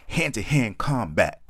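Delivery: overly animated poem line; really animated. Acted. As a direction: ham it up, drag every word out. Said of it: "I had to get really animated with that one".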